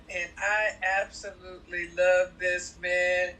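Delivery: ordinary talk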